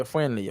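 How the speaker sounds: "friendly" said as "fren-lee", no D; silent D. Shown as a demonstration. Teacher confirming to student, "friendly" with a silent D. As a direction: drop the D in "friendly". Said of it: "ou pas prononcer D".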